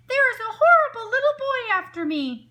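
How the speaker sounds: high, loud, panicked yell; yelling for help. A narrator's reading. Wolf tricking the shopkeepers, loud fake panic. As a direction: fake terror at the top of his lungs. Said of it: "yelled at the top of his lungs".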